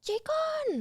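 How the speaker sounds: very high-pitched playful holler; calling out. Retold or imitated. Co-stars spotting each other on set, high playful call. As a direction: call the nickname out high and drawn out. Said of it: "we would see each other and be like".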